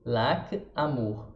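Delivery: "Lac" ending on a clean K, "amour" as a-MOOR; low, careful "Lac, amour" with French endings; French pronunciation. Shown as a demonstration. Teacher giving examples of letter A, careful French pronunciation. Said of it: "temos como exemplos lac e amour".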